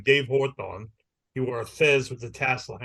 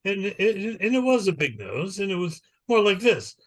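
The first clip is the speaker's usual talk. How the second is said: put-on mild English accent; 6 semitones higher than usual. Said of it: "And he said".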